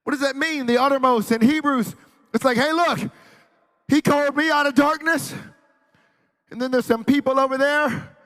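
high, loud preacher's delivery; impassioned preaching. Real, not acted. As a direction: press the verse home loudly. Preaches on a verse.

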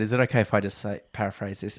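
low, hushed retold request; confidential aside. Retelling a friend leaning in to ask permission, confidential aside. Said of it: "you leant in and said".

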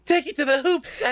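very high, loud cartoon kid brother; Mokuba. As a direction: squeal it out like an eager little brother. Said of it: "One Mokuba Kaiba".

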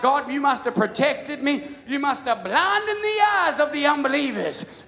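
high, loud thankful cry to God; mock grateful prayer. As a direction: praise God for dodging the ticket. Retold prayer to God, excited.

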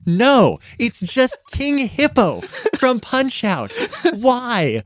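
high, slow disbelieving scoff; incredulous. Retold or imitated. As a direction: say it in mock bafflement, laughing. Mocking the fanfic's choices.

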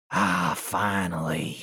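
slow relieved sigh before the words; dramatic sigh. Acted. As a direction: let out a long heavy sigh, then speak. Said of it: "she sighs heavily".

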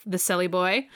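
ordinary delivery

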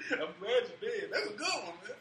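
high, loud laugh through the words; imitated villain laughing. Joker laughing as he leaves, imitated laughing delivery. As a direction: mimic a villain cracking up at his own joke. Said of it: "I like the laughing as he leaves".

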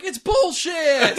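high, drawn-out mock-child shout; little kid shouting. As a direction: holler it slowly like a kid calling out a lie. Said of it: "we're like the little kids who are like".

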